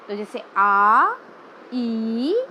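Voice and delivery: आ and ई drawn out slowly; long vowels. Shown as a demonstration. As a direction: hold each long vowel. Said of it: "जिनके उच्चारण में अधिक समय लगता हो". (धीरे खींचे हुए दीर्घ स्वर)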